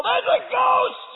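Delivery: high, slow, screaming panic; terrified yell. Retold or imitated. Disciples in the boat scream in terror at the ghost. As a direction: shriek it like you've seen a ghost. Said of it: "they yell".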